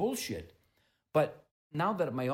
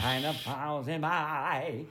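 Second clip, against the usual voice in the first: laughing; 3 semitones higher, 5 dB louder than usual. Pretend. Imitating a singer's mannerism.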